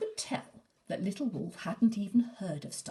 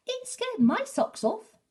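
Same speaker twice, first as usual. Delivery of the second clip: much higher and louder, a breathless fairytale princess; high girlish voice; 8 dB louder than usual. Acted. Cinderella praising a scary book, high and excited. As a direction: squeal it like a delighted young girl.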